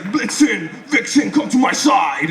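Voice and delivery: rally the reindeer like an action hero; Gerard Butler Santa. Pretend. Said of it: "And then he just".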